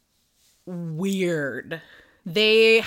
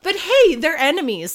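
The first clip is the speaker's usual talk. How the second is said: much higher and louder sarcastic quip; mock shrug; 7 semitones higher, 8 dB louder than usual. Real, not acted. Teller mocking the kingdoms' logic, sarcastic and loud. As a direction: toss it off sarcastically.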